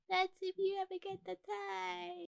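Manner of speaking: soft, high muttered singsong. Acted. Him muttering after the rabbit runs off, muttered. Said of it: "I swear I heard him mutter".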